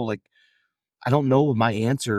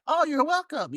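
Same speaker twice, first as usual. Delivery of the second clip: high, sing-song mock "you're welcome"; mock smug reply. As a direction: play it like a self-satisfied hero, pitched way up. Mocking an overly smug reply.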